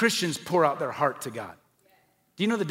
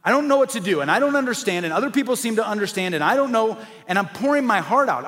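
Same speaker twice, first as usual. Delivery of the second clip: louder, higher, frustrated pleading; raw, impassioned venting prayer; emotional prayer; 4 semitones higher, 4 dB louder than usual. Shown as a demonstration. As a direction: pour it out to God, exasperated. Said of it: "with emotion, sometimes with frustration".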